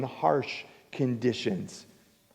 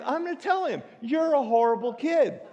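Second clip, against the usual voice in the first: mock outrage; 9 semitones higher than usual. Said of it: "And so I'm like".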